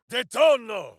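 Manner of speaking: high, loud one-word incantation; shouted spell word. Acted. Warrior triggering a spell in combat, shouting. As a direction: bark the spell out to set it off.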